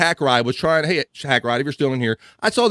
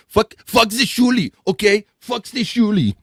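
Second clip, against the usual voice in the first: mock rival rant; 6 semitones higher than usual.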